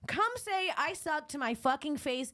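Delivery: yelled high and hard, picking a fight; raging challenge shouted across the room; furious yelling. Retold or imitated. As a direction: scream it at her, come at me. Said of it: "And I go".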